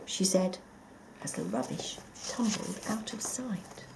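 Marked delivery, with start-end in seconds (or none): none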